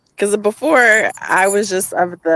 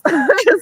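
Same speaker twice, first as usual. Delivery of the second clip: laugh; 12 semitones higher, 5 dB louder than usual. Real, not acted. Laughing after cussing.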